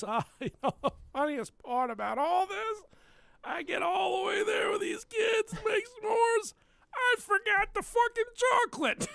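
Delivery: high, laughing, near-shouting telling of a funny story; laughing husband. Retold or imitated. Husband to the police, laughing high about forgetting chocolate. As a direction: laugh it off like it's a great joke. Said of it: "he even said to the police".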